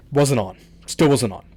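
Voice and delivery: ordinary talk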